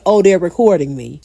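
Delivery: ordinary delivery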